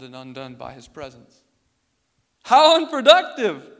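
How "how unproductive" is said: mock outrage. Mocking the idea of stillness.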